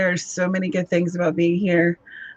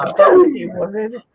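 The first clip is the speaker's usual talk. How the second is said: the dog giving up on her owner; voicing the dog; 2 semitones higher, 3 dB louder than usual. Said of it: "She's like".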